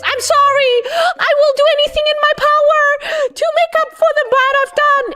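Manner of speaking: pleading apology. Acted. Sasuke apologizing to Naruto, high pleading. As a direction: beg forgiveness in a squeaky put-on voice. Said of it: "And Sasuke goes".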